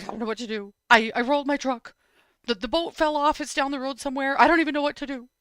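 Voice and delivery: imitated sobbing. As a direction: play a distressed dad, no real tears. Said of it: "sounded like he was sobbing".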